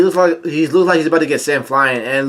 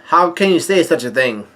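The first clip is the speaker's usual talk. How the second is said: normal talking voice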